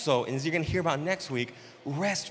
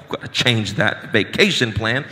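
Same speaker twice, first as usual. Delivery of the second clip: lower, louder mock-serious church leader; mock stern church voice; 7 semitones lower, 7 dB louder than usual. Pastor mock-scolding a member about vacation plans, lower and louder. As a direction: drop low and lay down the rule.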